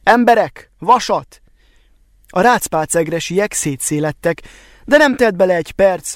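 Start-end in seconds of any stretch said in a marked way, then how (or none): 0.0-1.4 higher-pitched call to a crowd; raised, carrying narrated shout; loud call. A narrator's reading. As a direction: call out to the people outside. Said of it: "Jó hangosan, hogy a kintiek is meghallják".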